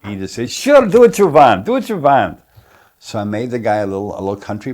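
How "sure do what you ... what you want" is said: louder, much higher, imitating a Russian director; Russian accent. Actor mimics the Russian director shrugging off accent questions. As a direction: toss it off breezily in a Russian accent. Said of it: "is Russian".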